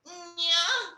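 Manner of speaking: very high, drawn-out porn-star voice; hammed-up squeal in a porn accent. Shown as a demonstration. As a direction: put on an exaggerated porn voice. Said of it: "That is a porn accent".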